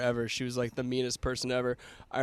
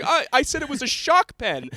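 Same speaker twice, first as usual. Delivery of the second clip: high, loud, stammering protest; panicked; 12 semitones higher, 8 dB louder than usual. Retold or imitated. Panicked student explaining himself to the teacher, high and loud. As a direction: imitate a kid pleading in panic. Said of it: "and I'm panicked".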